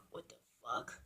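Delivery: whispered disbelief; barely audible aside; stunned whisper. Retold or imitated. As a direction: mouth it almost silently in shock. Stunned aside while watching sister.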